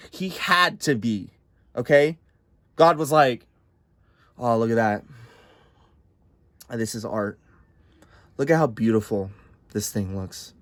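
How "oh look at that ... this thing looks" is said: hushed, low admiring murmur; mock awed God. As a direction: marvel at it softly like an artist. God admiring creation, hushed awe.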